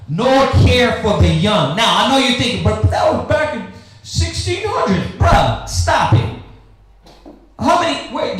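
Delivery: usual speaking voice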